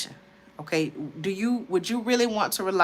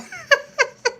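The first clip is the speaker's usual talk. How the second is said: high burst of laughter; laughing at herself; 4 dB louder than usual. Real, not acted. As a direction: crack up at her own mistake. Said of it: "I had to laugh at myself".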